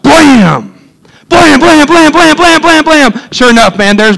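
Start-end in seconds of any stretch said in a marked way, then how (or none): 0.0-0.8 imitated first gunshot. Preacher retelling his hog hunt, imitating the first gunshot. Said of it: "first shot". 1.3-3.3 rapid high-pitched string of blams; imitated volley of gunfire. Preacher retelling his hog hunt, imitating rapid gunfire. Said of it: "first shot".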